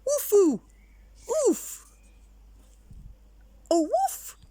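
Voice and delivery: high, loud garbled woofs; confused dog yelps; muddled barking. Acted. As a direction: bark all jumbled up.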